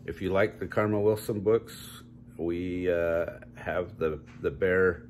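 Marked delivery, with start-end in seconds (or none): none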